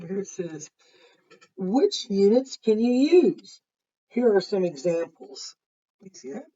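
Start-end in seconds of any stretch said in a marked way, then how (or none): 1.6-5.6 bright, louder storybook voice for Lisa; lively picture-book narration; reading to children. A narrator's reading. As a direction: read it to the class with energy. Reads book text aloud.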